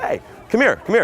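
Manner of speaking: high, upbeat beckoning order; commanding but fun. Shown as a demonstration. Pickup coach inviting a girl over, commanding but fun. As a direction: call her over to the better party. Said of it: "commanding, but also fun".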